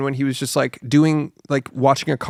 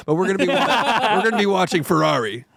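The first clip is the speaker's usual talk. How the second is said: laughing; 5 semitones higher than usual. Real, not acted. Laughing at their own bit.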